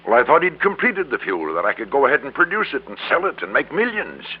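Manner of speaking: getting carried away by his own greed; excited greed. Acted. Greedy dream of making millions.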